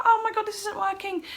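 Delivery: high-pitched panicky exclamation; frantic high wail. Retold or imitated. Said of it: "So I'm frantically just going".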